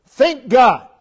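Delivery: 1 loud exhortation. Real, not acted.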